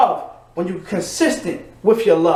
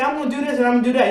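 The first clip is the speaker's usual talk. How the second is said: rattled-off promise; 2 semitones higher, much faster than usual. Retold or imitated. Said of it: "They say".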